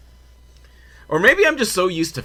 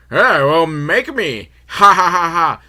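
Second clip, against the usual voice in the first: loud mocking taunt with a fake laugh; jeering hooligans; 4 semitones higher, 7 dB louder, slower than usual. Hooligans taunting the store lady, loud mock jeer. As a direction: play cocky thugs with a forced ha-ha.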